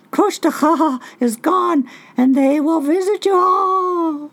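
high, loud laughing bird voice; Raven voice. Acted. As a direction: taunt like a cackling raven.

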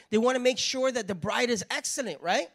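normal talking voice

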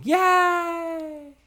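high excited cheer; cheering yay. Pretend. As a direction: squeal the yay. Cheering after announcement.

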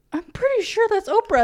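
high, loud amused protest; incredulous. Retold or imitated. Viewer corrects the subtitle about Oprah, incredulous. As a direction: protest in high-pitched disbelief. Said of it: "I was like".

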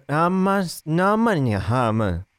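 imitated half-asleep gibberish; speaking in tongues. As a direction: mimic a sleeper babbling nonsense syllables. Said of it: "Speaking in tongues".

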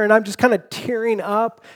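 usual speaking voice

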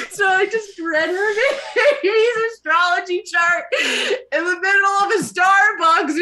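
high, loud, breaking up with laughter; can barely get it out for laughing; real helpless laughter through the words; laughing while telling. Real, not acted. Cracking up telling embarrassing story.

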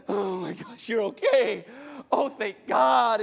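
hammed-up relief building to a shout; breathless relief growing loud and drawn out; overwrought relief. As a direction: blurt it out, overjoyed they are safe. Acting out relief at wife's return.

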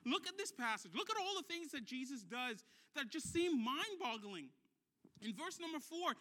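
ordinary delivery

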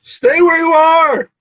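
high, loud, slow command; commanding shout. Acted. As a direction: bellow it to freeze them. Player calls out mid-chaos.

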